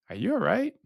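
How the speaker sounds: high-pitched concerned check-in; concerned question. Retold or imitated. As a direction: voice leaps up in imitated concern. Partner's concerned question, voiced high.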